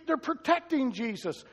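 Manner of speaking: ordinary delivery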